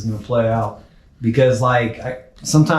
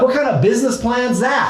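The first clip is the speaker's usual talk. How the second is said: high, baffled retold question; mock disbelief, pitched well up; incredulous; 11 semitones higher than usual. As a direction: imitate a coach who can't believe the plan. Coach questions client's odd plan.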